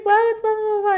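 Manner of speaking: high, loud mock brush-off; mock dismissive. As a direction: toss the blahs off mockingly. Mocking own vague answer.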